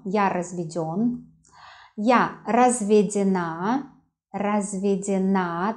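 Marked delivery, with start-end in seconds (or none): 2.0-5.7 разведена slowly, final на stressed and lengthened; teacher's slow demo of разведена with end stress; stress on last syllable. Shown as a demonstration. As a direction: lean on the closing НА of разведена. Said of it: "the stress on the last syllable".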